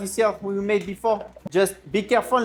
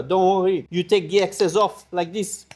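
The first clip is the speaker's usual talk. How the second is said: normal talking voice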